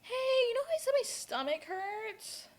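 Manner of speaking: high, put-on mom voice; imitating mom. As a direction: voice mom breaking news in a high lilt. Voices mom's phone call.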